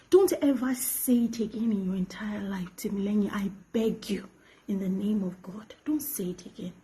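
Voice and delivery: soft, urgent whispered plea; pleading whisper. Real, not acted. To her former father figure, a whispered plea. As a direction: beg him under your breath. Begging him never to repeat it.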